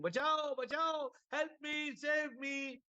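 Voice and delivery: high retold cry of a drowning woman; mock scream for help. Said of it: "started screaming".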